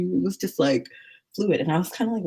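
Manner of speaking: ordinary delivery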